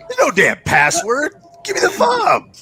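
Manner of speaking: high, loud frustrated shout; angry shout. Acted. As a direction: snap it out at Stanley. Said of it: "He goes".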